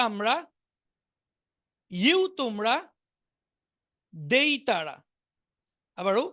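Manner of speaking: sing-song paired recitation, rising then falling; repeat-after-me drill. Shown as a demonstration. Teacher chanting pronoun pairs for students to join. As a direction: lilt each word and meaning together. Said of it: "একসাথে এভাবে পড়বো".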